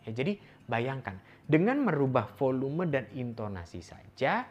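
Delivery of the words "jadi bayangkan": ordinary talk, nothing marked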